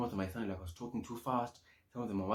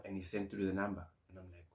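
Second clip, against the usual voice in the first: ordinary talk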